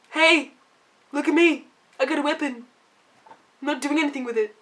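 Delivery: mock a useless party member; Varric voice. Mocking Varric standing idle in combat.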